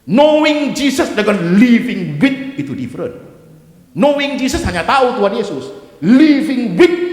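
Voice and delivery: loud, high, slow stretched key words; preaching at full force. Acted. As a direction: proclaim it at the top of the voice. (khotbah lantang penuh tekanan)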